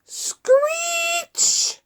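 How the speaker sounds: very high shouted screech; screeching brakes. Pretend. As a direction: shriek it like tyres skidding. Truck braking hard.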